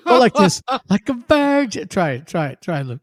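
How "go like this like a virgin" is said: high whiny nasal imitation; mock a whiny singer, pitched up; wimpy whiny voice. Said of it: "with a wimpy, whiny, nasally voice".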